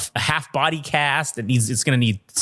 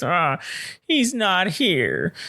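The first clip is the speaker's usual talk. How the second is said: put-on old lady voice; 5 semitones higher, much slower than usual.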